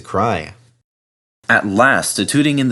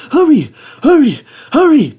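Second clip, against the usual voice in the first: panting; 9 dB louder than usual. Acted. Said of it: "panted Thomas".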